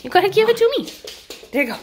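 high sing-song voice for the dog; talking to a puppy. Real, not acted. Playing with puppy, baby talk.

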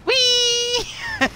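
very high squeal of fun; gleeful whee. Pretend. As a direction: squeal with delight on the ride. Riding a minecart.

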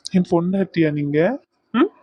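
ordinary delivery

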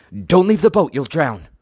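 loud, high cry of warning; urgent warning. A narrator's reading. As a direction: shout to hold her back. Warning over a fire.

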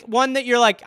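ordinary talk

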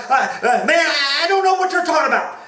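high, loud, rushed stammering denial; panicked denial. Acted. Peter to the accuser, panicked and rushing. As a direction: blurt it out fast in panic.

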